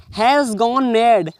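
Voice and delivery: very slow, drawn out phrase; slow reading aloud. Shown as a demonstration. Teacher reading the English phrase slowly to students. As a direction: give them every word slowly.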